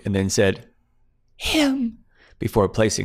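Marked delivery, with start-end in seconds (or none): 1.4-2.0 high, fearful "Him"; name the one she fears; dreadful whisper of fear. Acted. Claire's dread of the man.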